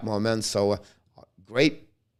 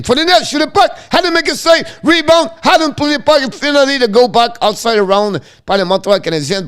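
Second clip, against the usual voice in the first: excited call; 6 dB louder than usual. Real, not acted. Goal chance, rebound scramble.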